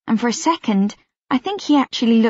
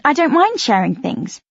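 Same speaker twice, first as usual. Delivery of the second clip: plain reading voice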